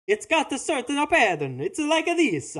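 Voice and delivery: high, sing-song cartoon Italian accent; traditional Mario voice. Shown as a demonstration. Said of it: "a traditional Mario voice".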